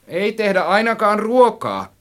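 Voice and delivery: high, loud, slow retort; gloomy snap. A narrator's reading. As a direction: snap it out loudly, drawn out. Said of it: "minä säistin synkkänä".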